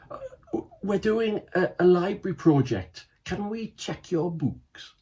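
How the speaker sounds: high, stammering, caught out; flustered stammer. Acted. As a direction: blurt a nervous excuse. Caught by mum, flustered excuse.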